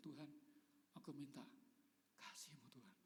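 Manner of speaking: whispered plea to God; hushed prayer. Retold or imitated. Pastor reliving prayer when wife lost memory, whispered plea. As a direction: breathe the prayer out, barely voiced.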